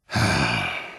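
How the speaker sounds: long, low exhaled sigh; weary sigh. Acted. Commander about to reprimand an initiate, heavy sigh. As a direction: let out a heavy breath.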